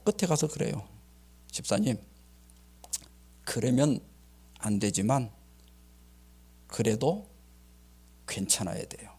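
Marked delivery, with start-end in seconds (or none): 1.5-9.1 low, soft, unhurried reassurance; gentle counsel. Retold or imitated. Pastor counselling a church deacon at the end, gently. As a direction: let each phrase settle, lower and softer.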